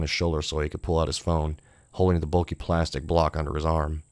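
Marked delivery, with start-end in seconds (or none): none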